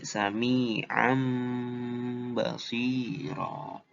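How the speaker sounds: "sami'an basiro" very slow, tanwin heard as a held M; iqlab reading. Shown as a demonstration. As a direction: hum a long M between "sami'an" and "basiro". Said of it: "Jadi bukan sami'an basiro".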